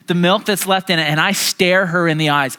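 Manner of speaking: ordinary delivery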